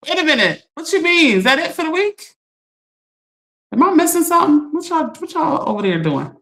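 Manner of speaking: incredulous surprise. Real, not acted. Reacting to "cook on a Monday.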